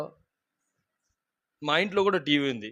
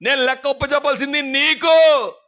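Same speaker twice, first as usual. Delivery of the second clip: higher, louder pulpit declaration; forceful preaching; 8 semitones higher, 8 dB louder, slower than usual. Acted. Preacher rebuking the congregation, loud forceful preaching. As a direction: preach it out hard to the church.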